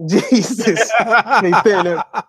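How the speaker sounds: slow, laughing through the words. Real, not acted. Host laughing to co-host about an annoying YouTuber, slow and laughing. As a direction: laugh it out slowly, half disgusted.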